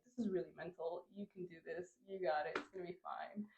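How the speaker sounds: soft murmured pep talk; quiet self-talk. Retold or imitated. Alone on the stairs, soft murmured pep talk. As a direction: talk yourself through it under your breath.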